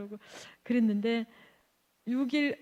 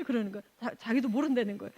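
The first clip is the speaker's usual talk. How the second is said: ordinary delivery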